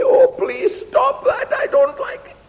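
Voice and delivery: very high, squealing plea; pleading complaint. Acted. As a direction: beg for it to stop in a squeaky protest. Acted plea against life's troubles.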